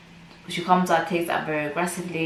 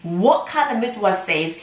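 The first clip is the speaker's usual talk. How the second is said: usual speaking voice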